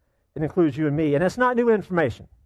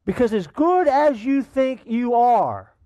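preaching emphasis; 7 semitones higher, 4 dB louder, slower than usual. Real, not acted. Preacher pressing a hard point.

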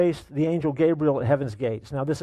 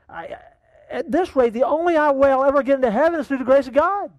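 panicking; 9 semitones higher, 4 dB louder than usual. Acted. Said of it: "panics a bit".

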